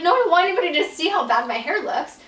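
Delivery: fast, loud, high-pitched refusal; panicked protest. Retold or imitated. Refusing husband's camera in a loud, fast, high panic. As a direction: blurt it out in a panic.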